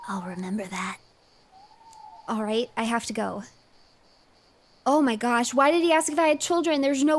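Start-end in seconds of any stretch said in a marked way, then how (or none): 0.1-1.0 put-on creepy kid whisper. Creepy boy whispering to the player about her eyes. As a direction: breathe it like an unsettling little boy.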